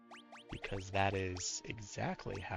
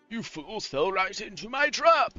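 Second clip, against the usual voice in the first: villain voice; 9 semitones higher, 6 dB louder than usual. Acted. Voicing villain dialogue.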